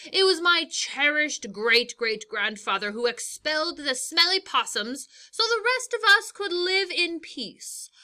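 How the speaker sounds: lightly voiced proud king, grand and pompous; lion's speech. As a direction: address the animals as their royal ruler. Lion gives campaign speech.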